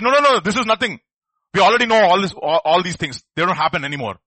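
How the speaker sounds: loud, dismissive no, no, no; a skeptic waving it all away; scoffing dismissal. Retold or imitated. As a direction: brush it off loudly as nonsense. Scoffing skeptic quoted loudly.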